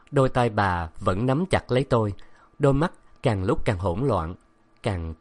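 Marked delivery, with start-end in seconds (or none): none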